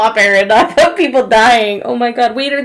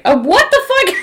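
high, laughing disbelief; shocked laugh; 9 semitones higher, faster than usual. Real, not acted. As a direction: blurt it out laughing. Shocked by the answer.

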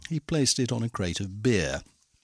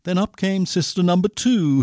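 usual speaking voice

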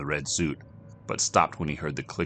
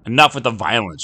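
louder, higher bartender shout; 7 semitones higher, 10 dB louder than usual. Acted. Bartender stopping the fight, louder shout. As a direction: yell to break it up. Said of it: "shouted the bartender".

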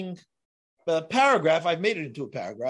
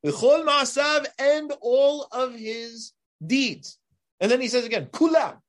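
kulam hit high and louder, slow; emphatic stress; 8 semitones higher, 4 dB louder, slower than usual. Shown as a demonstration. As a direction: drive home kulam, every deed counts. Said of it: "So very emphatic words".

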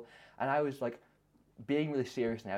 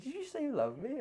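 surprised; 13 semitones higher than usual. Retold or imitated. Girlfriend quoted surprised.

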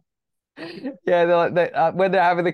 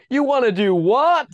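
high, louder disbelieving cry; incredulous shout; 9 semitones higher, 4 dB louder than usual. Retold or imitated. Tom shouting in disbelief from outside the house, incredulous. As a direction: shout it like you can't believe the plan. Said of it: "you hear Tom going".

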